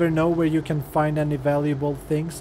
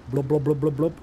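mouth-sound glugging; pouring sound. Real, not acted. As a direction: glug it like liquid pouring.

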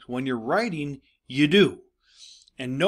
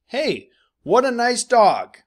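much higher and louder, slow and gushing; delighted exclaim; 10 semitones higher, 10 dB louder than usual. Shown as a demonstration. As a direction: gush over the dog like Kate. Teacher voices Kate's quote.

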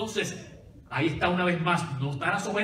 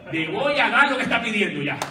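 loud, laughing mock surrender of a pestered judge; fed-up judge caving to the widow, louder and laughing; judge giving in, laughing; 4 semitones higher, 7 dB louder than usual. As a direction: throw up your hands and laugh it out. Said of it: "Esa es la versión mía".

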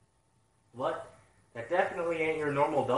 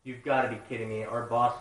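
usual speaking voice

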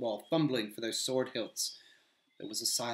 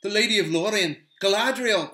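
higher, louder exclamation of her name; excited cry; 9 semitones higher, 10 dB louder than usual. Acted. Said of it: "cried Sam".

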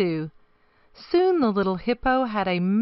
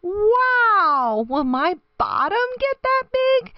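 put-on very high, louder baby hippo voice; playful; 13 semitones higher, 4 dB louder than usual. A narrator's reading. Baby hippo swimming with her daddy, playfully amazed. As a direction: squeal it with playful wonder. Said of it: "she giggled playfully".

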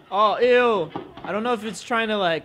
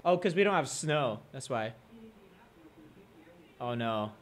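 ordinary talk

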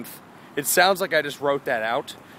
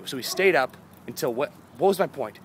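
ordinary delivery

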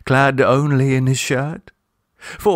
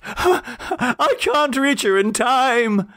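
high, slow, desperate gasp; panicking father who can't reach her; gasped plea; 13 semitones higher, slower than usual. A narrator's reading. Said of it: "gasped Octavian".

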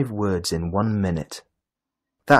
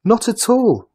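much higher and louder cry; excited cry; 9 dB louder than usual. A narrator's reading. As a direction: shout it out with eager denial. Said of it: "the carrot cried".

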